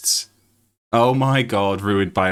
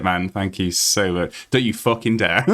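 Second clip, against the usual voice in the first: ordinary talk, nothing marked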